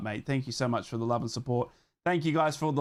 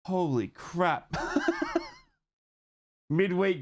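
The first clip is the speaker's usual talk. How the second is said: laughing disbelief; 4 semitones higher, slower than usual. Real, not acted. Laughing at early kickoff time.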